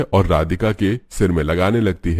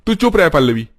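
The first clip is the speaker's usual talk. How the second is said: higher, louder sharp order; angry silencing; 10 semitones higher, 4 dB louder than usual. Acted. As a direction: brother telling his sister to shut up. Brother silences sister. (गुस्से में डाँटकर चुप कराना)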